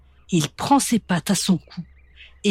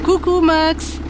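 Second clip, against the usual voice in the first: laughing; 9 semitones higher, slower than usual. Acted. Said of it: "répond Monsieur Moustache en riant".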